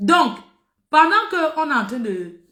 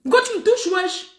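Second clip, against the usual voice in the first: pitched way up, exasperated; repeating the question at the girl, much higher and exasperated; high indignant cry; 8 semitones higher than usual. Retold or imitated. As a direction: throw the question at her, outraged. Repeating the confrontation.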